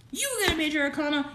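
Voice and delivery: first call of the card; excited. Real, not acted. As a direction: blurt it out fast and thrilled. Excited repeat of card news.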